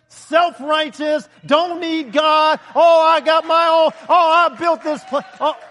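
loud, slow, hammering sermon build-up; yelling preacher. Real, not acted. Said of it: "I'm yelling at you".

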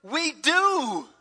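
loud, high confident yes; fervent answer. Retold or imitated. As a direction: declare your faith out loud. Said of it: "They said".

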